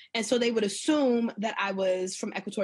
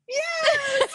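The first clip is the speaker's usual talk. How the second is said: very high, louder imitated shout; overjoyed cheer; 4 dB louder than usual. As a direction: squeal it like a delighted fan. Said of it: "They were like".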